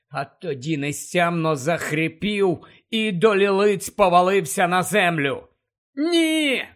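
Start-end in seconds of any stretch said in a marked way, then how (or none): 5.9-6.6 shout. Acted. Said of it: "крикнув Гаррі".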